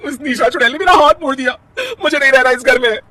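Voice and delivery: high, tearful wail to her husband; wronged wife sobs to her husband about Nisha, tearfully; crying complaint. Acted. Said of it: "रोते हुए".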